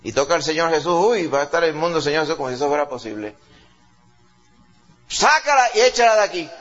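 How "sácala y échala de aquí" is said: higher and louder, fed-up order; irritated demand. Retold or imitated. Disciples telling Jesus to throw her out, irritated demand. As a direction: bark it like annoyed disciples.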